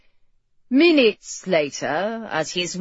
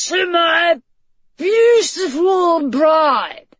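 raised, high-pitched proclamation, words drawn out; loud toast; 10 semitones higher, 6 dB louder, slower than usual. A narrator's reading. Tipsy groom toasts his new wife, loud proclamation. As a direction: proclaim it to the whole hall. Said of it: "said loudly".

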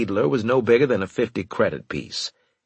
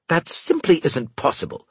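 sharp, higher snap; snapping; 9 semitones higher than usual. A narrator's reading. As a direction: snap it back, offended. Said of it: "he snapped".

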